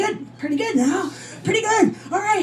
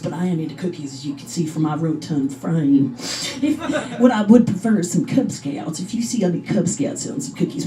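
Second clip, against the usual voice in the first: low creepy neighbor; 6 semitones lower than usual. Acted. Fat clown neighbor at door.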